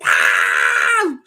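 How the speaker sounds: hammed-up grinning holler; mock gleeful yell. Mocking people smiling at conventions, long gleeful yell.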